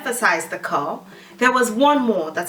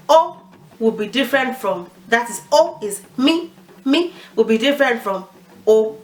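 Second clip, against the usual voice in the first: normal talking voice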